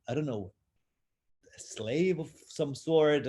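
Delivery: normal talking voice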